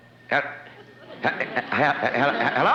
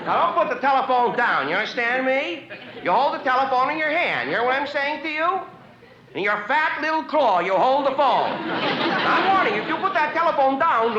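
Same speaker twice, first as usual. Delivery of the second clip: higher-pitched, hammed-up warning to a kid; mock threat; 6 semitones higher than usual. Caller warning a child not to hang up, mock menacing. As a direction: scare the kid into holding the phone, half joking.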